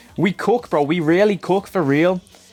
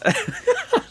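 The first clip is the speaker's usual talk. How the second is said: high squeaky laugh. Real, not acted.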